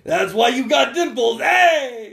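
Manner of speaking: loud, hammed-up New York wiseguy calls; big drawn-out New York accent, calling out. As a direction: put on a brash cartoon New Yorker. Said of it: "talking with a New York accent".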